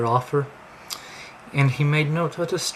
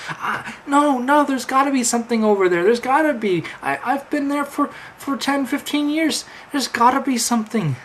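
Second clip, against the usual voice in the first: frantic; 8 semitones higher than usual. Acted. Quinter in denial, frantic.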